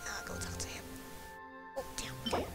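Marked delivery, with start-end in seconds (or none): none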